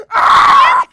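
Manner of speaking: piercing high, very loud shriek; full-blast high squeal; fangirl scream. Retold or imitated. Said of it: "I didn't fangirl in front of him".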